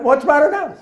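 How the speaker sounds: panicked backseater squeaking out the question. Retold or imitated. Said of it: "his voice goes way up".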